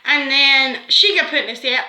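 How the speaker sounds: normal talking voice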